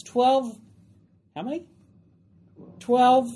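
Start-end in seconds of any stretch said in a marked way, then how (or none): 0.0-0.6 "twelve" set apart, higher and firm; stress on twelve. Real, not acted. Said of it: "emphasizing the word twelve". 2.8-3.3 "Twelve" drawn out and set apart; stress on Twelve. Real, not acted. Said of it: "Why am I emphasizing that?".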